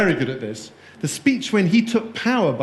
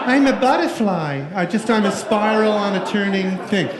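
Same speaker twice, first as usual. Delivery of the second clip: airy, spaced-out hippie; mock dreamy hippie, starting high; 3 semitones higher than usual. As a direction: float off like a blissed-out hippie. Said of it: "He's a hippie".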